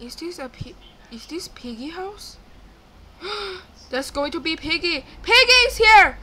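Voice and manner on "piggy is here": high, loud warning cry; alarmed shriek. Real, not acted. Player spots Piggy, alarmed shriek. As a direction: shriek that the monster has arrived.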